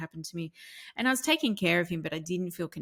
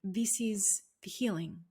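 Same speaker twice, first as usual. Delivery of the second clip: slow, hushed realisation; awed whisper; slower than usual. Retold or imitated. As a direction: whisper it slowly, overcome by the moment. Retold awed moment, whispered.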